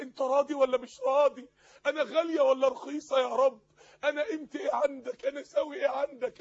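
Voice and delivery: mounting plea, building to loud cries; pleading supplication. Acted. Preacher voicing a believer asking God their worth, pleading loudly. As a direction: start restrained, then cry each question out. Voices anguished questions to God.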